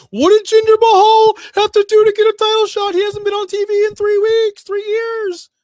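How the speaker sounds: mock outrage voiced very high and loud; shrill Tony Khan mock. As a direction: ham up his outraged tweet. Mocking Tony Khan's tweet.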